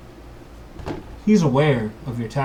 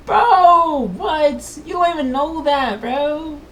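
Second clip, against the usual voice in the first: high, indignant whine; high protest; 5 dB louder, slower than usual. Acted. Player protesting the roll to the game master, high-pitched.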